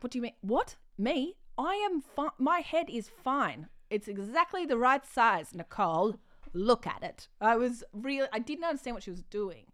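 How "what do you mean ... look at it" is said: mock defensive. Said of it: "I was like".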